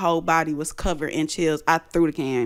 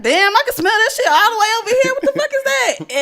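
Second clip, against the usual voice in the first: much higher, fast startled outcry; startled exclaim; 5 dB louder than usual. Retold or imitated. Smelling cologne out of nowhere, high startled outcry. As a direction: blurt it out, alarmed and high. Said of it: "And I'm like".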